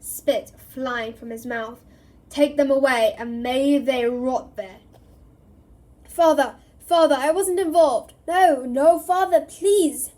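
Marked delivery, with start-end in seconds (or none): 2.3-4.8 very loud, slowing furious curse; raging roar. Acted. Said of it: "Crouch roared at the Dementors". 6.0-10.1 very loud high anguished pleas; desperate begging. Acted. Son dragged away begging father.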